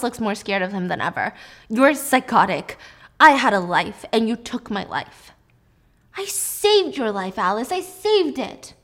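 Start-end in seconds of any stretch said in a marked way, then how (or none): 1.7-5.3 furious accusation. Acted. Alice accusing Jack of stealing her life, loud and angry. 6.2-8.7 slow, higher, pressing each word; insistent defense. Acted. Jack defending himself to Alice, slow and insistent.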